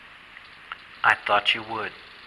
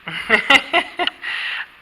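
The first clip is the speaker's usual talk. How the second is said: high delighted laugh; 10 semitones higher, 13 dB louder than usual. Real, not acted. Reacting to spirit activity.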